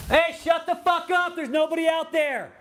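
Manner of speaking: real high, loud yell, drawn out; yelling at the dogs. Real, not acted. Yelling at his barking dogs to shut up, loud and high. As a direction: shout the dogs down from across the room. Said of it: "didn't mean to yell".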